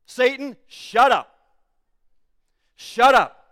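loud, forceful command; angry rebuke. Acted. Preacher telling Satan to shut up, loud angry command. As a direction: snap it loud at the enemy.

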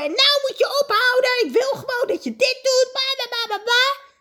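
hoge, gespeelde schreeuw naar de man; gespeelde ruzie. Pretend. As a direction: doe een ruzie na, half gemeend. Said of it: "tegen hem te schreeuwen". (gespeelde boze schreeuw)

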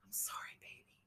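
breathless whisper, barely there; dying mother's whisper; faint whisper. A narrator's reading. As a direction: whisper it as her strength fades.

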